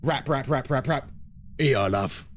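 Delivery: a hint of the no-nonsense server Sandra; imitating Sandra. Sandra wrapping the chips and charging him, lightly imitated. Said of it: "And she just goes".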